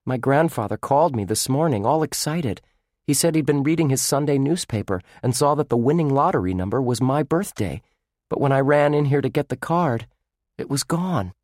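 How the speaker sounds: lightly voiced young boy; brightly explaining as a boy; boy's voice. Lucky recounts the lost ticket.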